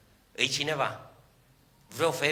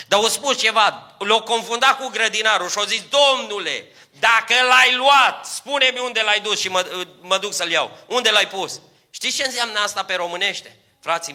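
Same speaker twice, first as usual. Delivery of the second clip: much higher, much louder fiery preaching; preacher thundering to the congregation about tears; preaching at full force; 9 semitones higher, 9 dB louder than usual. Acted. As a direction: lift the church with a ringing sermon. Preaching the Mary story.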